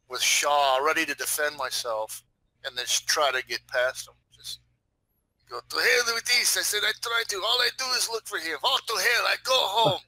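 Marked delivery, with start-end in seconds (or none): 5.6-10.0 high-pitched, fed-up ranting madman; crazy street rant. Acted. Player as crazy street person brushing off guards, ranting high.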